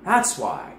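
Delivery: dawning realization. Pretend. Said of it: "And I'm like".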